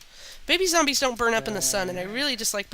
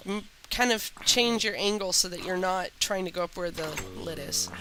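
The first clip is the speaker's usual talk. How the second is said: ordinary delivery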